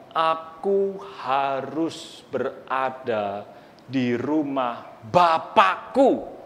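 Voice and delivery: slow and soft, each word weighed; slow reverent voice of the boy Jesus; slow, solemn. Acted. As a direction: say it gently and solemnly. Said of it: "Yesus katakan".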